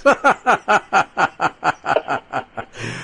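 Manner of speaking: trailing laugh that falls away; fading genuine chuckle; real laugh. Real, not acted. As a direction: let the laugh die down. Host keeps laughing at joke.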